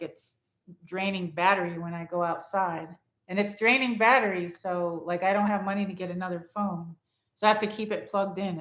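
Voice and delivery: ordinary delivery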